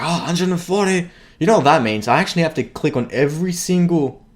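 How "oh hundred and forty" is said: higher-pitched mock dismay at the number; retold reaction. Vlogger recalling first seeing the submission count, retold higher-pitched reaction. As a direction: act out your reaction to the total. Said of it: "When I saw it, I was like".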